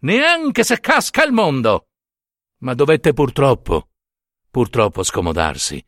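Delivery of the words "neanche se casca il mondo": molto più acuto e forte, sbotta; prete esasperato. Acted. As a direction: rifiuta sbuffando, non si muove più. (il prete alla sorella e alla serva, sbotta esasperato)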